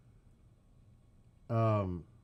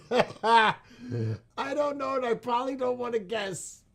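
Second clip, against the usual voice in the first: very high, loud, squealed through laughter; cracking up; 13 semitones higher, 8 dB louder than usual. Real, not acted. Streamer reacting to guesses on three S's, cracking up. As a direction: burst out laughing, voice shooting way up.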